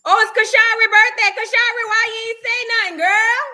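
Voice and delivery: high, loud burst of excitement; excited exclamation. Real, not acted. Host finds out it's Kashari's birthday, excitedly calling her out. Discovers a viewer's birthday, calls out.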